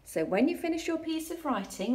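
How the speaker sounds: ordinary talk, nothing marked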